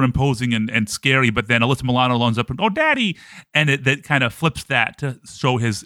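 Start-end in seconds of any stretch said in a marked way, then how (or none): 2.6-3.2 high squeaky little daughter voice; mock little girl. Dad's little girl calling out to him, mock high squeal. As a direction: squeal it like a small girl greeting dad. Quoting Alyssa Milano's child character.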